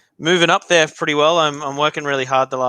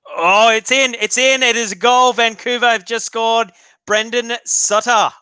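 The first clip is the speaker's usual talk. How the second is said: excited goal call; 9 semitones higher, 4 dB louder than usual. Real, not acted. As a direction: call the goal as it goes in, pitched up.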